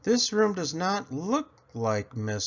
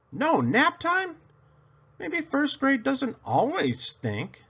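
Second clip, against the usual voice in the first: much higher, slow, amazed; delighted kid; 12 semitones higher than usual. A narrator's reading. Girl thrilled to hear there are no naps, high and slow. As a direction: savor the good news like a child.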